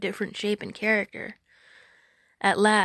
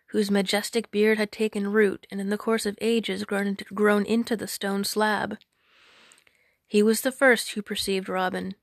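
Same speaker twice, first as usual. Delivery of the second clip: ordinary delivery, nothing marked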